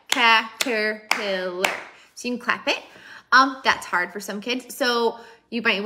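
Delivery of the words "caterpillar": caterpillar, slowly split into syllables; syllable-by-syllable demo. Shown as a demonstration. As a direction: say caterpillar slowly, chunk by chunk, as if clapping. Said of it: "So, like".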